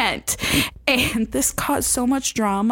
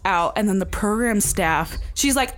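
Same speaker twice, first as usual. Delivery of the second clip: ordinary talk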